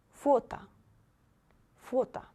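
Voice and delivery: Фото said "FO-ta", high first syllable; stressed O, then A. Shown as a demonstration. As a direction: model Фото with full "oh", then "ah". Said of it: "First O is stressed".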